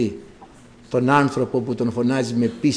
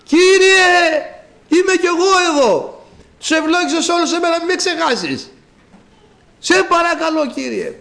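acted pleading shout; 14 semitones higher, 4 dB louder than usual. Blind man begs the Lord not to forget him, high shouting. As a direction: beg loudly from afar to be noticed. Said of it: "πήρε την τουντούκα και φώναζε".

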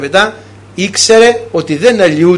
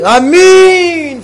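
high, loud cry of Amen; shouted Amen; 10 semitones higher, 3 dB louder than usual. Retold or imitated. Old bedridden sister shouting back to the priest, loud and high. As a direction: call out Amen with joy. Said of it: "φωνάζει".

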